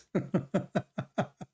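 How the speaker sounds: quick run of giggly ha-has; amused chuckle. Real, not acted. Storyteller after his punchline to the lady, amused chuckle. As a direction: laugh at your own punchline.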